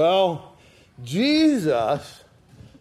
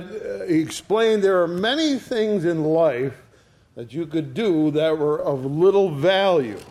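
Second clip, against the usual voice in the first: ordinary delivery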